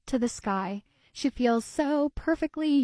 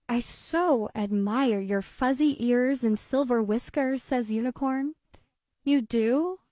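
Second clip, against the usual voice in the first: put-on lively read-aloud voice for children; storybook reading. A narrator's reading. Narrator reading a kitty tale to children, lively storybook voice. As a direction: read it like bedtime story time. Reads picture book aloud.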